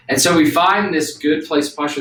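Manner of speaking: ordinary talk, nothing marked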